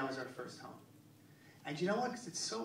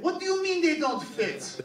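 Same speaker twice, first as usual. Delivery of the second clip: much higher and louder, incredulous challenge; indignant Israeli clerk; 12 semitones higher, 12 dB louder than usual. Retold or imitated. Israeli clerk rejecting a pants return, loud incredulous mock outrage. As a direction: bark back at the customer in disbelief.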